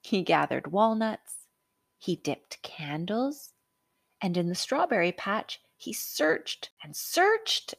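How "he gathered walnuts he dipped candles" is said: usual speaking voice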